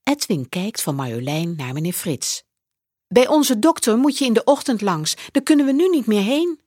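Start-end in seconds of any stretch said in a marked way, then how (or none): 3.1-6.6 put-on high, quick child's voice. A narrator's reading. Edwin explains about the doctor, high quick child's voice. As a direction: hurry it like a kid explaining.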